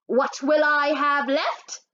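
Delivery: teacher voice. A narrator's reading. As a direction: spell out the question like a math teacher. Teacher asks Henry directly.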